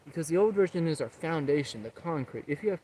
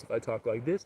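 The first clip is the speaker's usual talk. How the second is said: lower voice demo; 5 semitones lower than usual. Shown as a demonstration. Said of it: "the way we can alter our voice".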